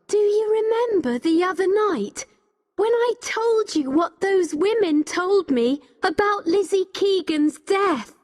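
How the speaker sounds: high-pitched, pressing recall; urgent argument. A narrator's reading. Becky urging Richard to remember, high and insistent.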